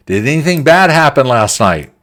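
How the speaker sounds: loud, high call across the empty office; shouting out. Retold or imitated. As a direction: call out to the room, half joking. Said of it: "And I shout out".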